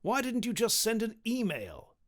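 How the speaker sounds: a hint of a cross king asking the obvious; exasperated king, lightly voiced. A narrator's reading. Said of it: "said the king".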